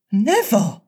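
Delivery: high, sudden cry of refusal; high-pitched exclamation. A narrator's reading. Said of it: "she cried and bobbed".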